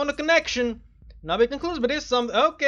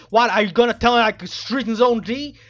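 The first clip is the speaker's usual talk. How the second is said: usual speaking voice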